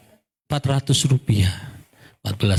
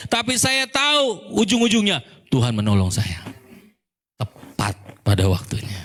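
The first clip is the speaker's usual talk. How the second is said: normal talking voice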